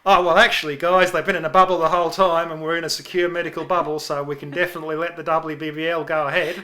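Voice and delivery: loud mock Australian accent. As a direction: put on a hammy Australian accent. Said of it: "you're doing your Australian accent again".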